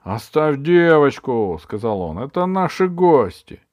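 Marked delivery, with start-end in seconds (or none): 0.0-1.6 slow, loud order; commanding shout. A narrator's reading. Chieftain orders witch off the girl. 2.2-3.6 loud, high-pitched insistence; commanding shout. A narrator's reading. Chieftain defends his guests.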